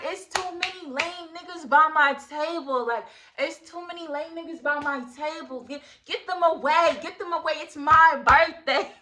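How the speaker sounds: high-pitched, insistent holler; yelling. Retold or imitated. Birthday girl yelling to her friend to clear her table. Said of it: "I'm really yelling this to her".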